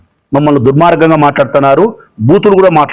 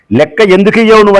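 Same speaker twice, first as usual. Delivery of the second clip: raised, higher retold demand for the money; forceful demand; 6 semitones higher than usual. Councillor confronting the school owner, raised demanding voice. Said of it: "అని గట్టిగా మాట్లాడినందుకు".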